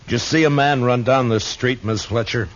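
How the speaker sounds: measured first-person narration over music; story narration. A narrator's reading. Dillon narrating his search for the killer, steady and low-key. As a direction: tell it like a tired lawman remembering.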